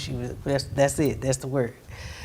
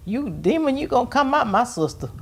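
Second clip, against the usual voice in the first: much higher, forceful mock of the brother; imitating brother's rebuke; 10 semitones higher than usual. Brother commanding the demon out of his sister, high-pitched mimicry. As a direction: act out the brother casting out a demon. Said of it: "he said".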